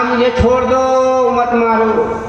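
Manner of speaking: high, drawn-out frightened plea; frightened Kaliyug. Acted. Kaliyug begs the king for his life, acted fearful pleading. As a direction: beg for mercy in a high, stretched voice. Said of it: "अब कलयुग डर रहा है, भयभीत हो गए".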